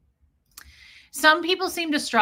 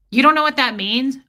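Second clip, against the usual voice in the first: loud, mocking ex-husband quote; condescending mockery; 3 semitones higher, 8 dB louder than usual. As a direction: sneer it like a smug husband. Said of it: "that passive-aggressive, laugh at you, condescending shit".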